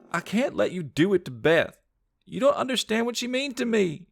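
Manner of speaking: very high, tearful plea; desperate cry. Acted. Sandra calling back to her brother, high and desperate. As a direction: cry out, sacrificing herself.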